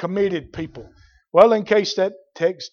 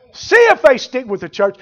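repeated louder and higher; 5 semitones higher, 6 dB louder than usual. Acted. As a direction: throw it out to the congregation. Repeats line quiet then loud.

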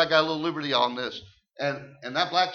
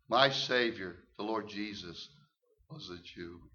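hushed reverent quote; 7 semitones lower, 6 dB quieter than usual. Retold or imitated. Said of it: "He said".

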